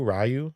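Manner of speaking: Ryu said as RYE-you; "Ryu" with a rye start, questioning; first variant. Shown as a demonstration. Weighing two ways of the name, RYE-you pronunciation. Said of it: "Ryu greater than Ryu".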